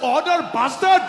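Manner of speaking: loud, high, drawn-out angry yell; exasperated shout. Acted. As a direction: explode at your friend. Loses patience with his friend.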